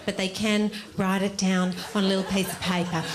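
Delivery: ordinary talk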